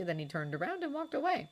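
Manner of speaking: ordinary delivery, nothing marked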